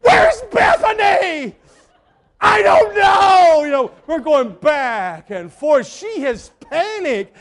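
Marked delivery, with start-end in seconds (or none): 0.0-1.5 panicked shout. Retold or imitated. Wife demanding where their child is, panicked shout. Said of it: "she looks at me and she goes". 2.4-3.6 much higher, louder, slow shout; shouted reply. Retold or imitated. Husband yelling back at his panicked wife, loud.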